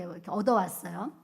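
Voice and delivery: usual speaking voice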